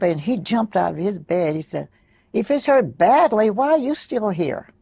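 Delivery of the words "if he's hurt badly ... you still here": put-on high, louder scolding child's retort; raised-pitch, insistent retold challenge; chastising. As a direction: imitate a boy indignantly taking an adult to task. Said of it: "he was chastising her".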